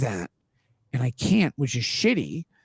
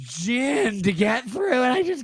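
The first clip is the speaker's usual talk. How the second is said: whiny voice; 8 semitones higher than usual. Shown as a demonstration. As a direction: whine like a sulky drunk. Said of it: "do it with a whiny voice".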